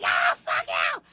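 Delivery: put-on high, loud teen yell; imitating the daughter's angry yell. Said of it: "She'd be like".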